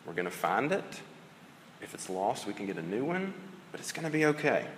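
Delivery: put-on calm a kid over a lost toy, gently; soothing. Parent hushing a crying child over a lost toy, soft and soothing. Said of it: "they're crying and you say".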